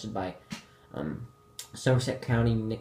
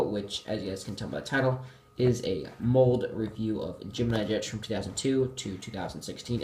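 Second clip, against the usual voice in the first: ordinary delivery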